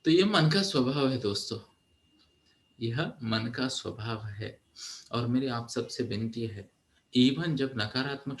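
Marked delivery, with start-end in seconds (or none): 2.8-4.6 softer and slower restatement; hushed, slowed emphasis; soft slow repeat. Shown as a demonstration. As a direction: let it sink in, slowly and quietly. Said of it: "repeated softer slower".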